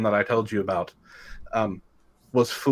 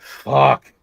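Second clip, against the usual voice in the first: retold exasperated curse; 4 semitones higher, 9 dB louder than usual. Retelling reaction to unwelcome payment.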